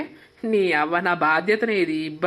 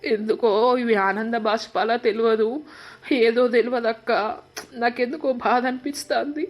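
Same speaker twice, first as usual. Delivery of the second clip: tearful voice building higher into sobbing thanks; crying; 5 semitones higher than usual. Acted. Brother thanking his sister through building tears. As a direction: voice rising and breaking as the tears build. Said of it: "ఎందుకు ఎడుతున్నావు చెప్పు?".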